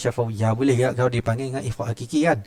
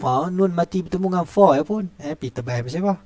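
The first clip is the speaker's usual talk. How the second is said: ordinary delivery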